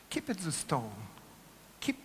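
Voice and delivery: ordinary talk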